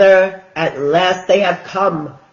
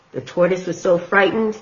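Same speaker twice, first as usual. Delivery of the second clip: plain reading voice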